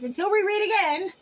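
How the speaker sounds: upbeat channel outro; sign-off. Acted. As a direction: close the reading like a host. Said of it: "sign-off".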